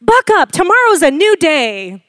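high, loud, brisk parental cheer; jolly a child along, acted out; hammed-up chin-up encouragement; mock pep talk.

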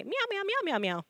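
soft meows, leaping high then dropping; imitated cat meows. Cat complaining to the Lord, softly imitated meowing. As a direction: mimic a cat quietly, meow after meow. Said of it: "God speaks cat".

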